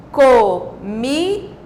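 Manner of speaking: COMIDA very slowly as co, mi, da; syllable by syllable. Shown as a demonstration. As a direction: break COMIDA into three separate beats. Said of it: "três sílabas".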